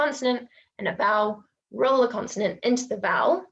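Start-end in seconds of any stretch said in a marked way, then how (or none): none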